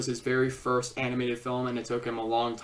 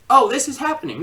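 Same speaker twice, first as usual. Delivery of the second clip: a sudden, higher, louder "oh" as if caught off guard; mock surprise; 9 semitones higher, 7 dB louder, faster than usual. Reviewer mimics a viewer's surprise, mock startled.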